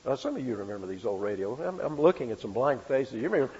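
normal talking voice

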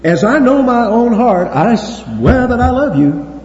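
high, loud, insistent vow; imagined Peter swearing he loves Jesus, loud and high; boastful Peter. Retold or imitated. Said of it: "he might have said".

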